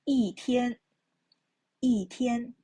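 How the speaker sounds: 一天 said yìtiān, 一 falling; teacher demonstrating 一天 tone sandhi; fourth tone yi. Shown as a demonstration. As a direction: model 一天 with a falling 一. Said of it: "because 天 is a first time".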